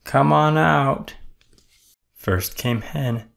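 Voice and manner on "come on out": louder, higher, slow call; toad calling out. A narrator's reading. Toad calling the hiding animals, loud slow call. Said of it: "laughed Toad".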